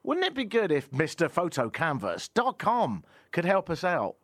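bright high ad voice; hammy radio ad pitch; ad read. Acted. Ad read continues.

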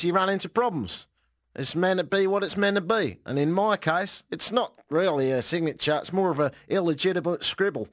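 put-on Australian accent for the quote; bad Australian accent. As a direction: do an amateur Australian, sliding off at the end. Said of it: "He said, definitely not in that accent".

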